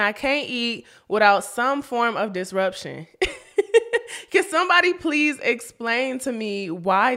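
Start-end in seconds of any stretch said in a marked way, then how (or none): 3.1-4.2 a short, high burst of laughter. Real, not acted. Laughing about baby disruptions.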